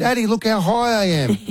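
put-on high, childlike voice calling to dad; dad mimics his daughter boasting on the wall, high and childlike; imitating little daughter. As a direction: play the small girl showing off her climb. Dad voicing proud daughter.

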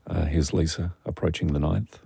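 hushed golf commentator voice. Pretend. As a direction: softly narrate like a golf broadcast. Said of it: "You need to talk like a golf commentator".

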